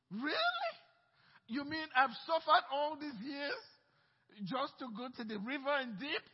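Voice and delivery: shrieking high, loud incredulous outburst with a laugh; he can't believe the cure is that simple; outraged disbelief. Acted. Preacher playing Naaman hearing the prophet's order, high loud indignant disbelief. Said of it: "He was mad".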